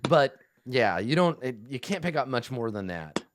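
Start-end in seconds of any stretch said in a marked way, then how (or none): none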